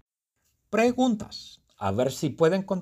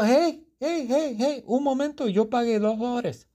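indignant protest; 13 semitones higher than usual. Acted. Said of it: "Pero yo digo".

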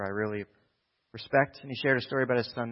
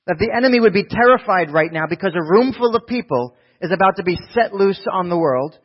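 high, loud fervent prayer; rising full-force pulpit prayer; preaching at full force; 7 semitones higher, 10 dB louder than usual. Real, not acted. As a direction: pray over them with power. Closing prayer crescendo.

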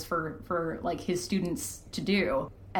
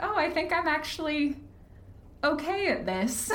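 pleased surprise; 8 semitones higher than usual. Retold or imitated. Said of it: "and was like".

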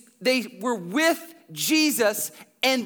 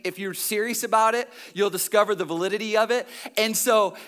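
ordinary talk

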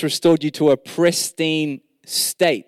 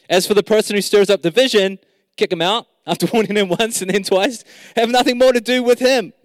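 preaching; 5 semitones higher than usual. Acted. Preaching at full force.

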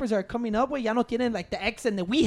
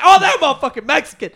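louder, higher, amused imitation of a listener; loud mock recognition; 4 semitones higher, 9 dB louder than usual. Acting out a listener hearing a Mexican rapper, loud amused recognition. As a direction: blurt it out as if spotting him instantly.

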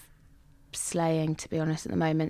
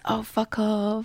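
mock brush-off; 3 semitones higher, 4 dB quieter, slower than usual. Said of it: "that girl says".

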